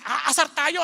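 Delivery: usual speaking voice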